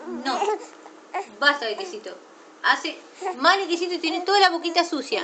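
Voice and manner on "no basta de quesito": lower, settled and firm; low final word on basta. Real, not acted. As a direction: drop the voice and lay down the rule. Mother refusing cheese twice.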